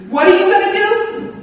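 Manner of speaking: high, loud sneering challenge; menacing taunt. Retold or imitated. Big man mocks the small pastor, loud high taunt. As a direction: throw the threat at her.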